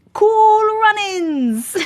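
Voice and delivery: high, loud, long drawn-out shout; excited callout. Real, not acted. Streamer naming the film to chat, excited callout. Calls out the film's name.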